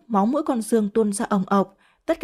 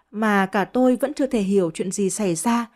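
ordinary delivery

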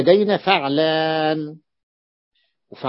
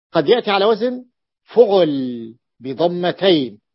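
ordinary talk

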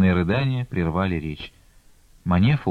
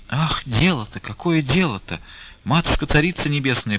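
lamenting; 6 semitones higher than usual. A narrator's reading. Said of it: "причитала мать Таифа".

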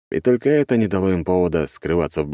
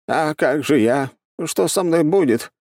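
whiny panic; 10 semitones higher than usual. Acted. Healer panics.

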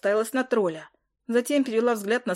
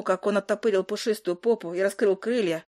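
ordinary delivery

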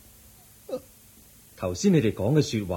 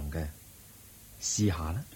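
whisper; 4 semitones lower, 9 dB quieter than usual. Acted. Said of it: "Buddha whispers encouragement".

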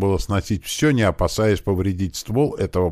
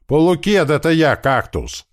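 calling out; 11 semitones higher, 6 dB louder than usual. A narrator's reading. Gunner hailing an acquaintance through the door, calling out. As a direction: shout to someone behind a door. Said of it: "крикнул наш пулемётчик".

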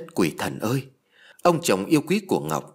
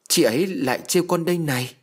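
higher, lightly voiced boy wailing; a small boy clinging to mom and crying; child crying; 6 semitones higher than usual. A narrator's reading. Son hugging mother, mock crying child. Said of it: "ôm mẹ khóc lớn".

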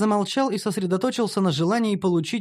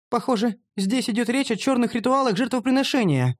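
higher female character voice, a touch of fright; understated fear in a raised pitch; mildly frightened; 7 semitones higher than usual. A narrator's reading. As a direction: observe it with slight alarm. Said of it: "заметила Шантри с испугом".

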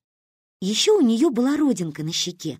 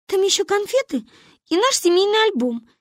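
put-on high-pitched little girl voice; earnest child; 13 semitones higher, 4 dB louder than usual. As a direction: hurry to explain the gift. Child explains gift to grandmother.

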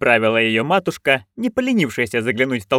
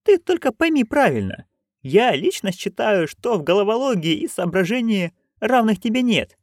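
put-on higher, eager old crone; Nanny Ogg voice; 9 semitones higher than usual. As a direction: pitch up into a gleeful old busybody. Nanny persuades her friend. (высокий азартный голос старой ведьмы)

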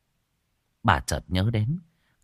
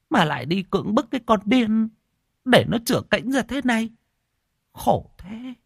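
indignant cursing; 13 semitones higher than usual. A narrator's reading. Old woman curses the man who abused the madwoman, high and angry. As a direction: curse whoever did this to her in pity and rage.

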